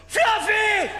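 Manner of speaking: high excited shout of his name; excited yell. Retold or imitated. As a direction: yell it like starstruck fans. Fans recognize him, yell his name.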